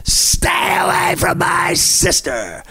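drawn-out, slow catchphrase yell; catchphrase shout. Retold or imitated. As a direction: stretch it out and belt it. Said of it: "I always say".

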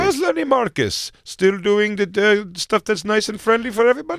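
high-pitched mock Indian cabbie; hammed-up high Indian accent. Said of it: "with an accent like that".

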